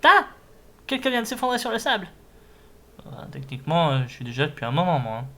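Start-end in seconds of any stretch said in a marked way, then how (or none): 0.0-2.1 high, fast cartoon voice; high character voice. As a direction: play a small excited creature. Voicing a Pokémon character.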